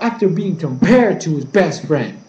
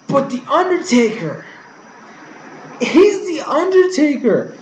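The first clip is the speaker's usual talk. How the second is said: hyped up; 8 semitones higher than usual. Real, not acted. Vlogger hyping the Undertaker's unbeaten streak, excited and awed. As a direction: build the legend with rising awe.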